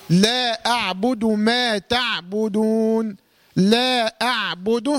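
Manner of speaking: لا أعبد ما تعبدون recited very slowly, letters light; slow tajweed recitation. Shown as a demonstration. Teacher models the verse slowly for the student. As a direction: draw out each word of لا أعبد ما تعبدون. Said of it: "كل حروفها مرققة".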